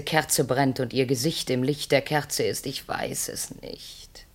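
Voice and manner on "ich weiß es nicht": slow, soft whisper; slow hushed whisper. Acted. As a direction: drop to a breath, as if confessing. Confession, then sudden whisper.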